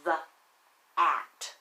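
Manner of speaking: usual speaking voice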